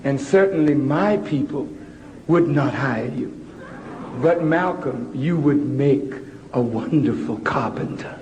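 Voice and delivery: very low, measured teacher with long pauses; discouraging teacher. Retold or imitated. Preacher quoting the teacher who discourages Malcolm, low measured teacher voice. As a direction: drop right down and let the pupil down in measured phrases. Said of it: "The teacher said".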